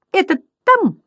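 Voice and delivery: high comic announcement; clown butterfly. A narrator's reading. First butterfly to the others, high clown voice. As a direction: pipe it up like a little clown.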